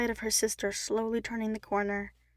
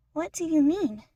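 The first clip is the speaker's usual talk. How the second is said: put-on higher, louder puzzled chipmunk girl; Brittany, confused; 7 semitones higher, 6 dB louder than usual. Brittany asks Ian what he means, confused. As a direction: ask it genuinely lost.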